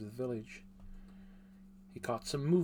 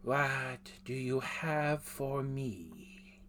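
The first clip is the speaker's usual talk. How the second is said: slow, strange foreign-sounding voice; odd accented voice; much slower than usual. Acted. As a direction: draw it out like a hooded stranger. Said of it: "spoke in an odd voice with an accent".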